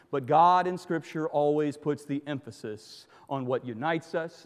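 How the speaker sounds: ordinary talk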